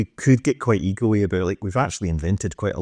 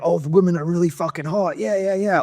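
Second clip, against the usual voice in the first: higher, leering mock enthusiasm; pitched-up jokey leer; mock lecherous voice; 8 semitones higher than usual. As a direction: play a dopey, eager fan of the West. Mocking Western propaganda's message.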